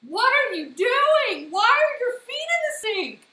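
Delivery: loud, high, slow shocked yell. Retold or imitated. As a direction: belt it out like you walked in on chaos. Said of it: "and he just goes".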